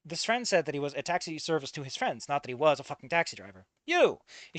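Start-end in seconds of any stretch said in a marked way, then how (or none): 3.9-4.2 high shocked cry; shocked shout. Acted. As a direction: cry out in recognition. Recognizing the groom, shocked.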